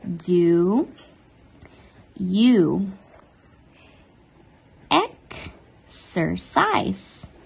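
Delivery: "Do you exercise?" each word spaced and slow; slow spaced teacher model; slow model question. Shown as a demonstration. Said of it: "A pergunta seria".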